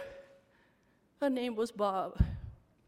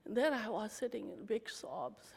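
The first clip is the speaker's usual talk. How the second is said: ordinary delivery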